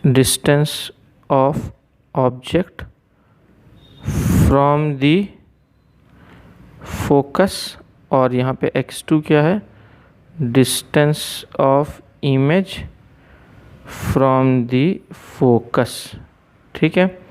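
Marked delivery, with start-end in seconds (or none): 0.1-7.5 word by word with long pauses; slow dictation. Real, not acted. Dictating a definition while writing it. 10.4-16.2 word by word with long pauses; slow dictation. Real, not acted. Dictating a definition while writing it.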